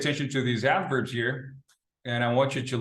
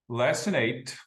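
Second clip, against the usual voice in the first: usual speaking voice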